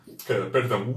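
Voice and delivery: ordinary talk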